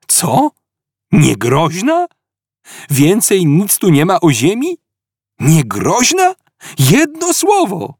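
high, indignant outburst, slowing on the last words; outraged disbelief. Acted. Arthur outraged that Earth gets one word, indignant cry. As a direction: splutter in outrage.

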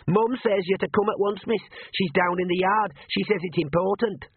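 put-on high, boyish piping voice; eager young messenger. A narrator's reading. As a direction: deliver mum's message urgently, pitched high. Sean delivers mother's message.

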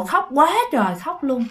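ordinary delivery

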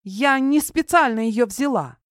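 put-on high, frightened girl's voice; panicked protest. A narrator's reading. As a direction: plead innocence in alarm. Cornered girl protests her innocence.

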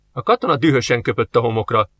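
plain reading voice

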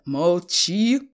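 explosive, cutting-off yell; very loud shout. A narrator's reading. Father cutting off his student son, furious shout. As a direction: bellow it over the other person. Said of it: "крикнул на него отец и так громко".

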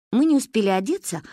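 ordinary delivery, nothing marked

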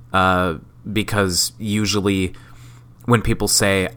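usual speaking voice

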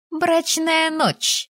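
high-pitched, very drawn-out reveal; conspiratorial drawl. A narrator's reading. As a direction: stretch out the juicy secret up high. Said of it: "протянула заговорщическим шёпотом". (заговорщически, высоко и протяжно)

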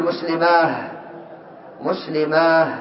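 "مسلماه" ending in long aah, muslimaah; final t as h. Shown as a demonstration. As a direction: stop on "مسلماه" with a breathed h in place of t. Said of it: "من يقف بالهاء".

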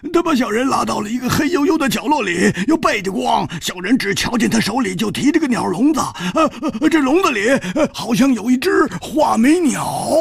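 a burly man whimpering his excuses; whining big man. Acted. Big man pleads with Chu Liuxiang, whiny and flustered. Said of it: "这个大汉说".

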